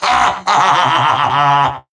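smug villain cackle; evil laugh. Acted. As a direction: cackle with arrogant delight. Villain laughs at own power.

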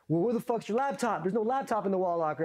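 high-pitched, put-on instructor voice; imitated angry interrogation. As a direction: challenge his alibi like a riled instructor.

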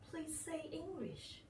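slow, high-pitched retold plea; retold pleading. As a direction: imitate an insistent, polite request. Retells repeatedly begging for English.

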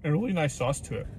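chewing while talking; eating a burger; mouth full of food. Real, not acted. Seen 1: Just bitten burger; cheeks working early, then plain talk with burger in hand.

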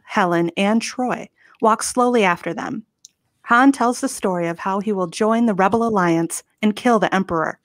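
ordinary talk, nothing marked

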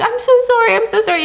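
high, rushed sorry; frantic mock apology; panicked apology. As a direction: blurt it out in a panic.

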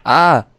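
real jump-scare yell; startled scream. Real, not acted. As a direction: cry out as if jumped.

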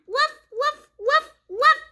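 high little woof woof of a puppy; puppy bark. Acted. The puppy Biscuit barking back, high happy woofs. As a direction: yip it like a happy small dog.

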